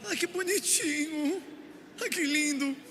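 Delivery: high, slow cooing voice of a besotted father; doting dad. Retold or imitated. A father cooing at his newborn over nothing, high and slow. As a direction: coo over the baby like a melting dad.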